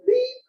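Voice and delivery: high-pitched slide-change beep; imitated beep. Presenter mimicking the cue for the next picture, imitated beep. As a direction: squeak out a beep like a machine. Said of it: "PowerPoint things where I'd say".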